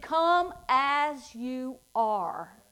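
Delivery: slow, drawn-out come as you are; slow invitation. Acted. Preacher slowly repeats God's invitation to the church. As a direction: stretch each word, inviting.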